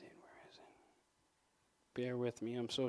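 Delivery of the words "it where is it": real whisper to himself; flustered whisper, looking for his notes. Real, not acted. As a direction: whisper it while searching the page. Hunting for lost notes.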